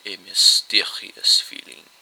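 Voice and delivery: slow, mysterious detective voice; drawn-out hint of mystery; mysterious Poirot. A narrator's reading.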